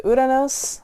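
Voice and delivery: Uranus said Spanish-style as "oo-rah-nos"; Uranus with an opening "oo" to avoid the silly English reading; Spanish pronunciation. Real, not acted. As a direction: say Uranus with a Spanish accent, tapped r. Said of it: "Spanish accent".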